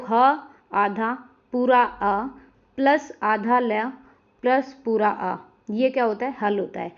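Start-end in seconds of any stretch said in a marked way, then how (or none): 0.1-5.4 slow spelling-out. Shown as a demonstration. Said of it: "हल को विच्छेद करना है".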